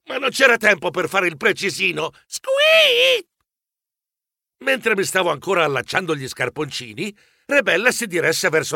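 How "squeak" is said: sharp high mouse squeak. Acted. Frightened mouse squeaks before the trip down.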